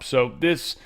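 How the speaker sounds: usual speaking voice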